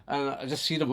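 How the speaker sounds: ordinary talk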